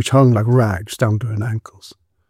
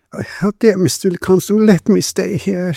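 high, pleading woman's voice; plaintive plea, pitched well up; tearful lisp; 7 semitones higher than usual. A narrator's reading. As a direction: beg to stay, high and tearful. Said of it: "she lisped through her tears".

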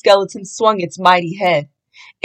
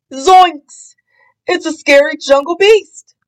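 frightened yelp; 6 semitones higher than usual. Acted. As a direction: yelp it like you just saw a monster. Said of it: "Shaggy yelped".

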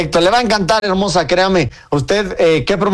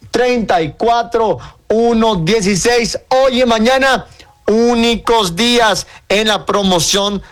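slow, drawn-out ad read with raised pitch; radio promo pitch; 5 semitones higher, slower than usual. Real, not acted. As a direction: sell it on air, phone number spelled out slowly.

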